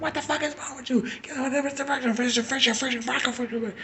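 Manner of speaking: high, fast hammed-up angry father; high, fast mock furious father; furious dad imitation. Father yells at daughter.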